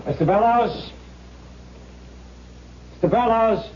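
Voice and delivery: calling out. Acted. Officer calling offstage for the missing actor, calling out. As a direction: call his name off toward the door.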